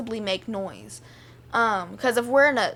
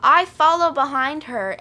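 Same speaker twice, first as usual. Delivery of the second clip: normal talking voice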